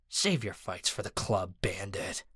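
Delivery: low growled warning; growling guard. A narrator's reading. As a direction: growl it at him. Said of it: "the guard growled".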